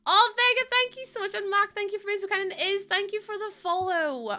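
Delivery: very high, sustained sing-song delight; gushing thanks. Real, not acted. Streamer thanking followers by name, high and gushing.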